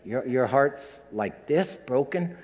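put-on a light touch of a little boy's voice; five-year-old asking. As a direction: ask it the way a small child would. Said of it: "the five-year-old kept going".

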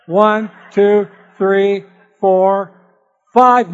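loud, deliberate counting restart. Shown as a demonstration. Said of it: "I gotta start over again".

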